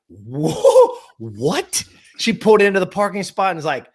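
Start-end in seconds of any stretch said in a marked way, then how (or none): 0.1-1.8 drawn-out whoa, then questioning what; mock shock. Parents hearing the story, mock shock. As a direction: react like an appalled parent.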